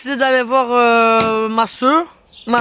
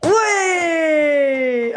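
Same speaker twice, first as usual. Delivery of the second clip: excited cheer; 4 semitones higher than usual. Real, not acted. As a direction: cheer the shot. Cheering child's basket.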